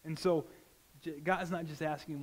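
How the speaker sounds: ordinary delivery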